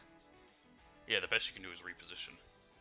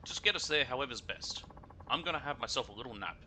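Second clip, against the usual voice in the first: loud, rude, higher passenger voice; brash Kansai lady; 3 semitones higher, 5 dB louder than usual. Acted. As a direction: bark it like a pushy out-of-towner. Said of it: "Sounds like she's from Kansai".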